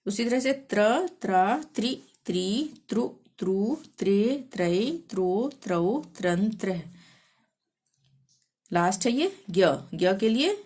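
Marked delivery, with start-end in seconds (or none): 0.7-6.8 measured sing-song letter chanting; syllable drill recitation. Shown as a demonstration. Teacher reciting Hindi syllables for learners, sing-song drill. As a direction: recite each syllable separately with a lilt.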